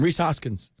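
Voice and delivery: normal talking voice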